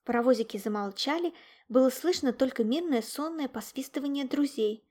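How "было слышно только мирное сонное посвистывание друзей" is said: ordinary delivery, nothing marked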